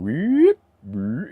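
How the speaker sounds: high pitched siren weep; siren imitation. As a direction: mimic a warning siren. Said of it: "like, going".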